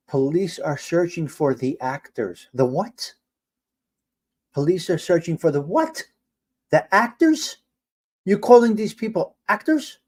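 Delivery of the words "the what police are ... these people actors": rising, disbelieving questions; incredulous. Real, not acted. Streamer questioning a news network's wording, incredulous. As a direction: echo it back higher in disbelief.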